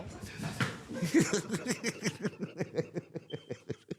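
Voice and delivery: soft laugh at his own story; can't hold back a laugh. Real, not acted. Laughing while telling a prison story.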